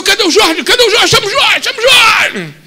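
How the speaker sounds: high, loud calling for Jorge; excited calling out. Acted. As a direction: shout across the camp for Jorge.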